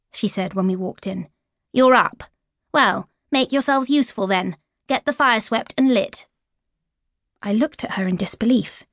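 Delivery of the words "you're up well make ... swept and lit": lightly voiced stepmother, curt orders; a hint of a cold stepmother giving chores; bossy stepmother. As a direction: boss the girls about. Stepmother Edith ordering girls.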